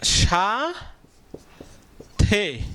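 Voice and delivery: slow dictation drawl; drawn out slowly. Shown as a demonstration. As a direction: stretch সাথে very long. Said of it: "sorry".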